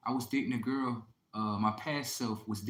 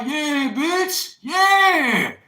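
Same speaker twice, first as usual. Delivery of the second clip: very high, loud drawn-out yell; triumphant yell; 13 dB louder, much slower than usual. Retold or imitated. Boyfriend gloating at stunned girlfriend, yelling high. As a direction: crow it at her at the top of your voice. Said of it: "I'm like".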